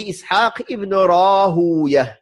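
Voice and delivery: Rahuya slowly, with long oo and -ya; original Persian pronunciation. Shown as a demonstration. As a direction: draw out Rahuya as ra-HOO-ya, as in Persian. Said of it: "comme il est prononcé à la base".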